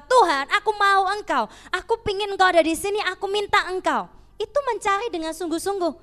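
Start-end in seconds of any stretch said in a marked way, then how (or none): 0.0-4.1 high, loud plea to God; crying out. Acted. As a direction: cry out desperately, begging God to come. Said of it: "engkau menjerit dan berkata". (seruan memohon, tinggi dan keras)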